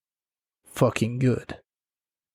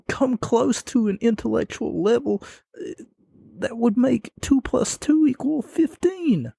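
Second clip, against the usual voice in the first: mocking rant; 10 semitones higher than usual. Mocking rant about Owens.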